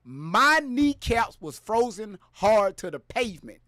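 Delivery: loud, high, ranting storyteller voice; hyped-up comic rant. Acted. Streamer telling viewers a tall tale about the heat, hyped shouting.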